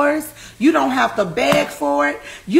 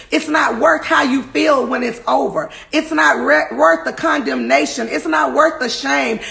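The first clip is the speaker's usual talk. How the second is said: normal talking voice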